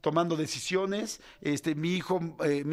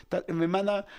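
ordinary talk

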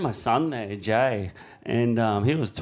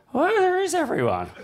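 much higher, laughing disbelief; amazed laughing question; 3 dB louder than usual. Retold or imitated. Out on the huge swell with nobody around, laughing amazement. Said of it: "I was like".

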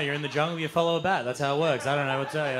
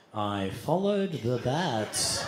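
very slow, lower-pitched declaration; mock-solemn confession; 5 semitones lower, much slower than usual. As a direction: draw out each word with mock gravity. Solemn signing of guest book.

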